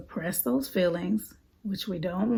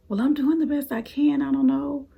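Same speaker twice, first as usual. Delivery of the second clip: put-on higher, timid child voice; anxious child thoughts; 6 semitones higher, 4 dB louder than usual. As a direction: voice the scared kid's doubts. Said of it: "thinking in their mind".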